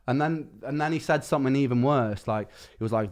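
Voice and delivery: ordinary talk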